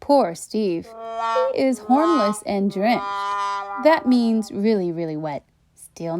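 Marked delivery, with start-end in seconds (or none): none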